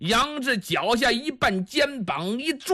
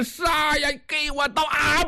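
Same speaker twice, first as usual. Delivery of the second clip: goading shout; 6 semitones higher than usual. Acted. The bully Niu Er dares Yang Zhi to strike, high taunting shout. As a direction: shout the dare in his face, higher.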